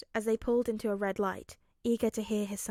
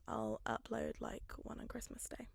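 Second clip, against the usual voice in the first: hushed teasing whisper; 9 dB quieter than usual. Real, not acted. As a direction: let them in on a secret. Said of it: "teasing whispered promise".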